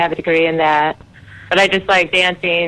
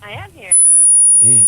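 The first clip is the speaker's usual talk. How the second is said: faint, tinny phone voice; over a landline phone; 15 dB quieter than usual. Real, not acted. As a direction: sounds trapped inside the speaker. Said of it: "She's trapped in the amp".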